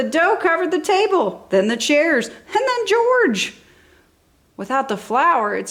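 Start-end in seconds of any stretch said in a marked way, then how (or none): 2.3-3.6 much higher and slow, comic amazement; read-aloud gasp at the silly mess; surprised. A narrator's reading. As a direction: stretch it out in wide-eyed disbelief. Dough covers George, comic climax.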